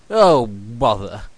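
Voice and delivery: slow Pooh bear voice; Pooh's "oh bother". A narrator's reading. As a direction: sigh it out as a put-out bear, slowly. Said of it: "said Pooh".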